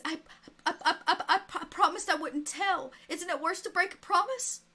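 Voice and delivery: put-on a kid too afraid to get the words out; lightly voiced boy pleading with the preacher; frightened boy.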